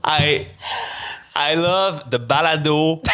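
each word laid out slowly and deliberately; slow careful English. Shown as a demonstration. Careful take of the English line, very slow. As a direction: say it slowly for the host. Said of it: "with the other version".

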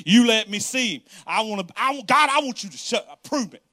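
loud, insistent demand shouted at God; demanding prayer. Retold or imitated. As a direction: pray like you're bargaining hard. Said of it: "How many of us ever prayed this prayer?".

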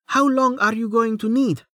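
high-pitched call over the wind; shouted question. A narrator's reading. Woman flying with Rain through the air, shouted question. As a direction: pitch it high to carry, without full volume. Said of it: "she shouted".

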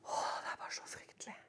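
fast, breathy, appalled whisper; horrified whisper. Real, not acted. Preacher whispering his horror at Satan's deceit. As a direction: gasp it out in dread.

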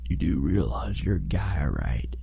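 hushed aside whisper. Real, not acted. Joking aside about being a guy.

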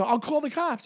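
imitating his own threat; retold threat. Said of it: "I go".

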